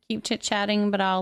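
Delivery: ordinary talk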